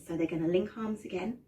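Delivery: plain reading voice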